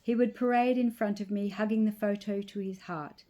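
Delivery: usual speaking voice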